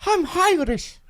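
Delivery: high, lilting Irish accent. Shown as a demonstration. Told to do it again, a high Irish accent. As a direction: pitch the Irish lilt way up. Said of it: "Do it again".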